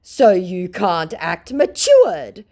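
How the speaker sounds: slow, loud, high mock scammer; mocking the scammer slowly. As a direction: drag it out as the scammer. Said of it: "said my twelve-year-old Nigerian scammer".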